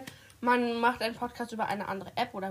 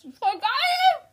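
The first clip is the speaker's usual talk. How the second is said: excited; 14 semitones higher, 5 dB louder than usual. Real, not acted. Cheering after the applause.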